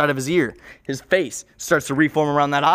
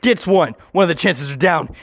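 ordinary delivery

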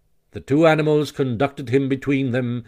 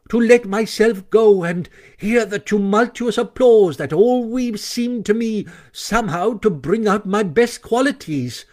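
theatrical Toad; 7 semitones higher than usual. A narrator's reading. Toad's theatrical lament.

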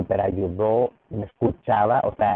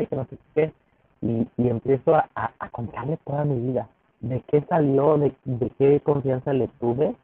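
usual speaking voice

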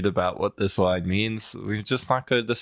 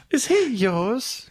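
put-on high, slow questioning boy voice; 12 semitones higher, slower than usual. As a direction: ask it slowly, pitched high. Same quote repeated.

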